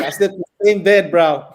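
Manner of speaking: laughing as you boast about the bed; laughing brag. Real, not acted. Bragging about Ronaldo's bed.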